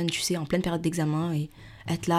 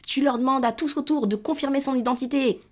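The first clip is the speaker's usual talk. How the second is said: high-pitched imitation of a bossy dad; father giving orders; 8 semitones higher than usual. Father ordering his daughter to question the adults, high mock scolding. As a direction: mimic a parent dictating instructions, high and insistent. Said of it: "il commence à m'engueuler".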